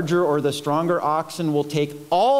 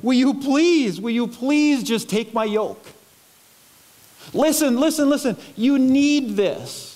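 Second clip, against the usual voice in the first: put-on like a father pleading with his kids; 4 semitones higher than usual. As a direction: beg them earnestly, pitched up. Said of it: "like, pleading and, and be like".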